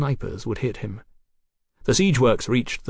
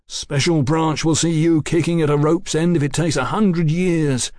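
raised, insistent threat; angry shout; 5 semitones higher, 4 dB louder than usual. A narrator's reading. Policeman vows to see the captain hanged, angry. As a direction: hurl a vow at your tormentor. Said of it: "Fraser shouted suddenly, stung beyond endurance".

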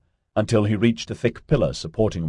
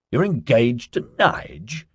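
slow, higher-pitched disbelief; surprised; 5 semitones higher, slower than usual. A narrator's reading. Ozzy learning Melanie is engaged, surprised. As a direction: draw the question out in surprise. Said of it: "face screwed up into surprise".

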